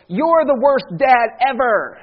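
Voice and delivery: loud, drawn-out mock tantrum; put-on indignant child's wail; whining child. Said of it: "this is a direct quote".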